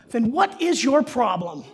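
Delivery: put-on loud, slow exasperated kid with a laugh; child scolding. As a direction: hammer the punchline like a fed-up little brother. Little brother's punchline to sibling.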